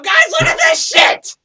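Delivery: loud, high mock shout across the shop floor; yelling supervisor. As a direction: holler it out to show everyone.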